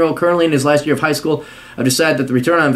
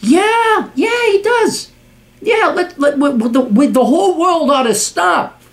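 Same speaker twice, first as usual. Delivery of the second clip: extremely high, sputtering mockery; sarcastic outrage; 14 semitones higher, slower than usual. Real, not acted. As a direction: agree in screeching sarcasm. Sarcastic rant peak.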